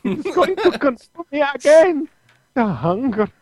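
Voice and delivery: high, slow, whiny cartoon vampire. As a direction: whine like a tormented cartoon villain. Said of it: "that little whiny voice in my head".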